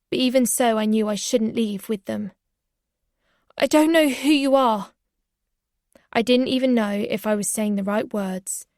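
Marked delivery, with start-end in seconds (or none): 3.6-4.9 raised, slurring fear; drowsy panic; frightened. A narrator's reading. Drugged narrator pushing back at the strangers, frightened.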